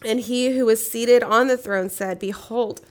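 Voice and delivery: solemn, measured Bible reading aloud; scripture reading. Acted. Reads Revelation aloud.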